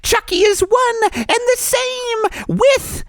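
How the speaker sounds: high, crying villain confession; wailing high-pitched reveal of the killer; a sobbing, shouting killer doll confesses; Chucky voice. Acted. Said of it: "too close to Chucky's voice".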